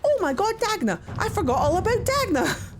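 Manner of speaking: high-pitched burst of sudden remembering; startled excitement. Real, not acted. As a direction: cry out as if you've just remembered her. Suddenly remembers a forgotten character.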